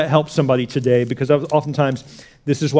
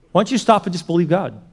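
ordinary delivery

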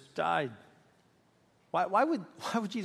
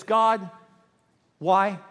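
higher, louder, with a long pause between words; anguished demand; 3 semitones higher, 6 dB louder than usual. Shown as a demonstration. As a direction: cry out to heaven for a reason. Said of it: "it was more like this".

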